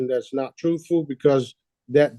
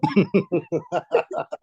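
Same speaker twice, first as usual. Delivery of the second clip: short higher-pitched laugh; real laughter; 5 semitones higher than usual. Real, not acted. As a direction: keep chuckling at the joke. Still amused by own joke.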